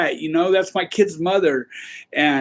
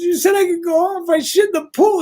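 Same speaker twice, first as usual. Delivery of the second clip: a much higher shouted protest; kid shouting back; 10 semitones higher than usual. Retold or imitated. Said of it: "He goes".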